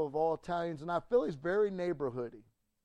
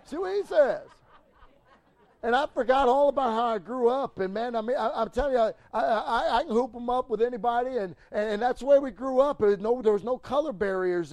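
loud, high, laughing build; a preacher hitting full stride; fired-up preaching; 6 semitones higher, 8 dB louder than usual. Real, not acted. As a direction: ramp it up with a laugh.

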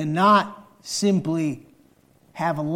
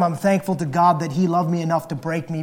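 ordinary talk

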